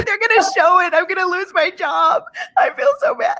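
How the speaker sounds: high, crying mock panic that breaks into a shout; a distraught rant about losing her job, cried out high; upset crying. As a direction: cry it out like your career just ended. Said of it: "I was, like, really upset".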